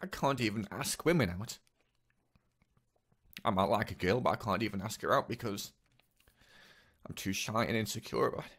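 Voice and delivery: real upset, breaking off mid-sentence; choked up. Real, not acted. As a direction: struggle to get the words out. Upset recalling his father's humiliation.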